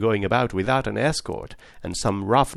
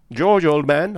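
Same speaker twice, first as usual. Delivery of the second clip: calls out louder down the stateroom; raised call into the cabin; shouting; 5 semitones higher, 5 dB louder than usual. A narrator's reading. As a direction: shout for his friend George. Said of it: "I shouted".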